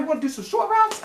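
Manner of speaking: high, mocking question to the coaches; sarcastic mock question. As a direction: needle the play caller with a high, sneering question. Mocking the coaches' play calling.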